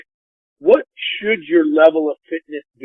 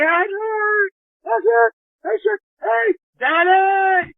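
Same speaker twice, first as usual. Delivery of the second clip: put-on very high, drawn-out kid calling out; mock child's call through the house; imitated excited kid; slower than usual. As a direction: holler for dad in a squeaky held pitch. Retold boy calling his dad.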